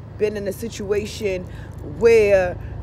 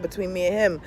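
ordinary delivery

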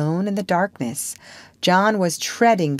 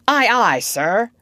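loud, higher demonstration of an order; firm, louder naval response; commanding model phrase; 4 semitones higher, 4 dB louder than usual. Acted. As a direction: show a recruit how to answer the captain. Peter models the required answer.

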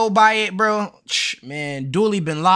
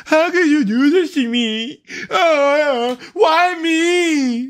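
mocking fake dork; 8 semitones higher, much slower than usual. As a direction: overplay the crybaby dad until he yells. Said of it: "at least get a little bit more realistic with your fake dork".

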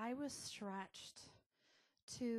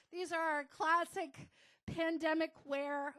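laughing through the words, a bit louder and higher; 6 semitones higher, 11 dB louder than usual. Real, not acted. Laughing to the group about stretchy pants.

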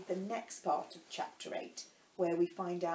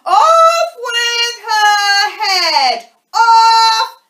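high, very loud, long-drawn shriek; furious scream; 25 dB louder, much slower than usual. A narrator's reading. Queen glares at Alice like a wild beast, screaming furiously. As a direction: scream the order, stretching every word.

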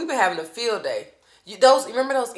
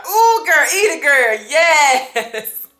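hype cheering; 6 semitones higher, 12 dB louder, much slower than usual. Real, not acted. Mom hyping up her daughter at the table, loud cheer.

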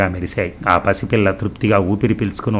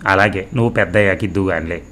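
ordinary delivery, nothing marked

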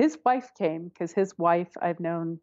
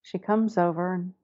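ordinary delivery

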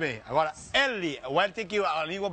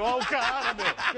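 laughing bluff; 3 semitones higher than usual. Acted.

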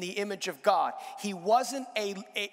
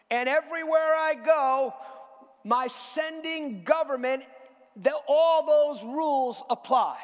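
preaching at full force; 6 semitones higher, 5 dB louder than usual. Acted. Preaching peak.

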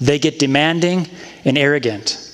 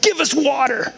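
higher, curt demand for water; rude demand; 9 semitones higher than usual. Retold or imitated. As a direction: bark it like an entitled crowd. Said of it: "That's how it sounded".

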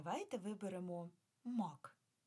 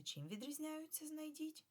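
1 high quiz question; 10 semitones higher than usual. Shown as a demonstration. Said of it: "teacher asks class".

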